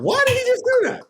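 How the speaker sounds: very high, incredulous question; comic high-pitched bafflement; baffled disbelief. Retold or imitated. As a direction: squeal it in total bewilderment. Acting out his baffled thought.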